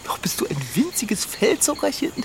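high, soft coo at a cute animal; cooing baby talk. Acted. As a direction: melt over the fluffy baby. (süßlich gurrend)

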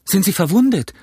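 put-on high, startled boy's question; alarmed cry. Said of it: "schrie ich". (erschrockener, hoher Ausruf)

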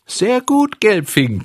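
high, loud squeak of a tiny voice; tiny voice mocks, high and loud; shrill little voice. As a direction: screech it like a mocking sprite. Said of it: "kreischte ein feines Stimmchen".

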